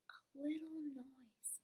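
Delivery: slow soft unvoiced whisper. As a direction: whisper it very slowly. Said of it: "whispered the rabbit".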